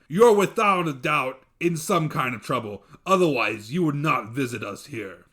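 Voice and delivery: loud booming no; firm dev. Acted. Dev refusing the lad's excuse, loud. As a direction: cut him off.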